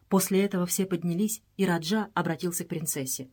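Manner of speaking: usual speaking voice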